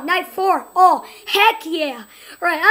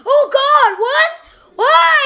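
high, loud frightened cries; real panicked outburst; panicked yelling; 8 semitones higher, 7 dB louder, slower than usual. Real, not acted. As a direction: cry out in shock. Jump scare reaction.

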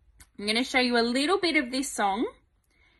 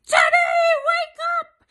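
put-on much higher, louder child call; mock wake-up shout; 10 dB louder than usual. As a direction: yell to wake Daddy, playfully. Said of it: "Instead of going".

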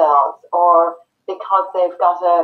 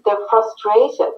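ordinary talk

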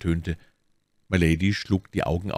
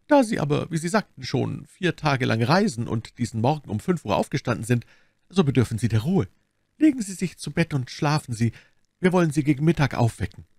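lightly voiced abbess, pitched higher; caring abbess; 7 semitones higher than usual. A narrator's reading. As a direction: urge the tired guest to rest, voice lifted.

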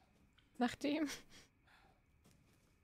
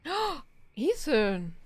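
loud, slow, higher tut-tut; drawn-out mock reproach; mock scolding; 6 semitones higher, 8 dB louder, much slower than usual. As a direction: scold him like a shocked granny. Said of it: "Er sagt mal böse Worte".

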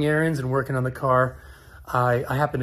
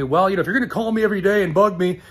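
fast, higher, louder whiny complaint; imitating annoyed owner; 5 semitones higher, 4 dB louder, faster than usual. Owner complaining to a customer, fast and annoyed. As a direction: gripe it like he's fed up.

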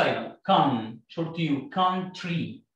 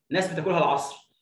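ordinary delivery

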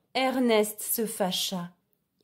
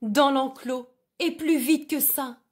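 mild, higher-pitched shouted order; shouting over noise; 5 semitones higher than usual. A narrator's reading. Sheepdog ordering sheep over engine noise, raised higher voice. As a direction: call it out to be heard over an engine. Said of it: "cria-t-il pour se faire entendre".

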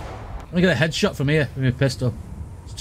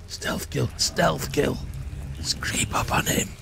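hushed stealth whisper; 2 semitones lower, 6 dB quieter, slower than usual. Pretend. Gamer creeping up behind an enemy, whispering.

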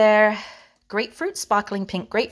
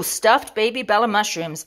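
ordinary talk, nothing marked

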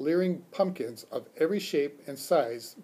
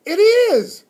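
very high, loud delighted cry; high-pitched thrilled shout; delighted discovery; 16 dB louder than usual. A narrator's reading. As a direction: exclaim with joy at finding it. Phoebe recognises her lost watch.

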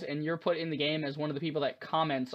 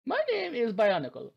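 put-on high-pitched German accent; 7 semitones higher, 3 dB louder than usual. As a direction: introduce yourself in a hammy German accent. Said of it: "I may just do a German accent".

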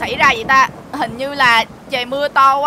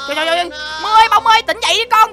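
higher, urgent wake-up calls; urgent pleading; 7 semitones higher, 4 dB louder, faster than usual. Acted. Owner over his collapsed pets, urgently pleading. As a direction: plead with them to come round.

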